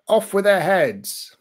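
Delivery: mock royal command, drawn out; a hint of a haughty queen; posh queen impression. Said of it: "How would the Queen say it?".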